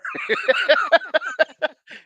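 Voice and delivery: high squealing laugh; burst of laughter. Real, not acted. Laughing at own cowering.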